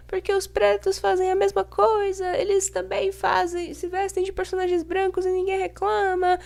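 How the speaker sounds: high, whiny mock commenter voice. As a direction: sneer it out in a squeaky whine. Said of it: "mocking a racist commenter".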